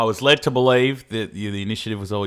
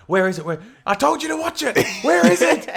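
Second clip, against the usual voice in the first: much higher panicky voice; imitating a frantic searcher; 13 semitones higher than usual. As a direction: ape a flustered housemate hunting the mouse. Imitating partner hunting mouse.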